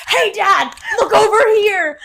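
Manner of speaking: high, very loud, insistent yelling; attention-seeking kid shouting. Retold or imitated. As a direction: holler for Dad like an annoying child. Child self pestering Dad on camera.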